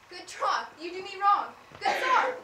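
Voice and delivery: high, loud wounded protest; rising hurt anger; hurt outburst. Acted. Helena protesting that Lysander mocks her, hurt angry outburst.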